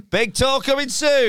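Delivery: mock hype announcement; promo hype. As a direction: sell it like a trailer. Plugging the tour.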